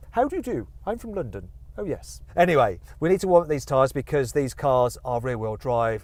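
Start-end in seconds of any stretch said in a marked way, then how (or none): 0.1-2.2 mock-refined London accent, pitched higher. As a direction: put on a proper London voice as a joke. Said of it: "learned how to talk like this".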